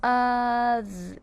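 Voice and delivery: "Of" held long as uhth, on one level note; sounded out. Shown as a demonstration. Teacher showing the syllable in another, drawn out. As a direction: draw out each sound of "Of". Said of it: "Now we have the syllable".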